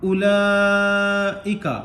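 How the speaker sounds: उलाइका chanted very slowly with the ला drawn long and the वाव silent; recited slowly. Shown as a demonstration. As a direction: stretch ला in उलाइका like Quran recitation. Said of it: "उलाइका में जो वाव है, इसको नहीं पढ़ेंगे".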